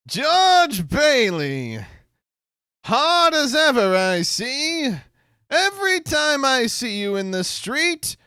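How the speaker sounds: put-on high, slow sneering old man; a hammed-up old movie villain voice; creaky old villain. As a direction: drag every word out like a leering old miser.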